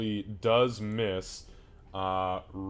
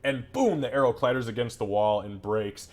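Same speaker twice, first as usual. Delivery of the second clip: ordinary talk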